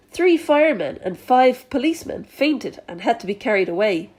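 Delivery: ordinary delivery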